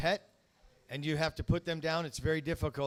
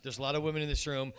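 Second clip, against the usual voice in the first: usual speaking voice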